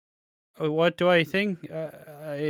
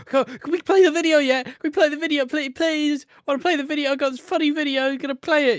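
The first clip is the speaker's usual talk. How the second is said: put-on much higher, louder whiny little-kid voice, begging; Piggy; 12 semitones higher, 6 dB louder, faster than usual. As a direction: beg like an impatient little kid. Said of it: "Piggy was like a little fucking like".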